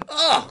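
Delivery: high, loud cry; yelp of pain. A narrator's reading. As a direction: cry out at a sudden headbutt. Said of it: "cried Pe-, Billy".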